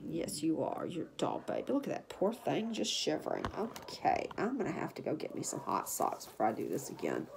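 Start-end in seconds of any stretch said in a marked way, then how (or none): none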